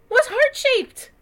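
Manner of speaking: drawn out, high and loud with delight; excited. A narrator's reading. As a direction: marvel at the heart-shaped box. Excited reading of heart box.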